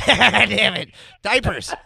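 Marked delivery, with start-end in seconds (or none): none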